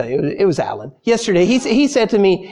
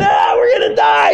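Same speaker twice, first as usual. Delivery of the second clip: mock panicked scream, much higher and louder, fast; hammed-up panic; 3 dB louder than usual. Disciples bailing water, hamming up a panicked scream. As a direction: play the disciples losing it in the storm. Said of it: "all freaking out".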